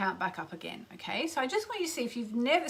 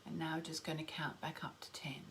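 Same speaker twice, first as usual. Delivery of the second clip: whispered, slow guided meditation; slow soothing countdown; 4 semitones lower, 12 dB quieter, slower than usual. Acted. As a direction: count them down softly into stillness.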